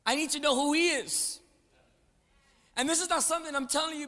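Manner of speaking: loud, high, building chant of faith; full-force preaching. Acted. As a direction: preach it loud so the room answers.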